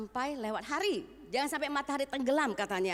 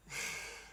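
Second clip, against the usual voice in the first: a long soft calming exhale; demo deep sigh; 8 dB quieter than usual. Shown as a demonstration. Preacher shows the congregation how to breathe out anger, soft sigh. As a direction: breathe out slowly to show letting anger go. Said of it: "tarik nafas".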